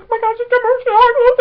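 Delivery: high, squealed excited babble; breaking into high, loud squeals; freaking out. Retold or imitated. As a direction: squeal it down the phone to a friend. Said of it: "I'm, like, freaking out".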